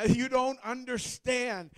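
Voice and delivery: a husband begging his wife to understand the win; impassioned plea. Retold or imitated. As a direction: pour out the years of heartbreak to her. Pleading with wife, tears.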